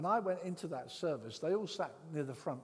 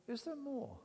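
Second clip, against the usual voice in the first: higher, a lightly voiced young woman asking; eager student; 11 semitones higher than usual. The student asks the preacher, lightly voiced and curious. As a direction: sound like the curious new believer.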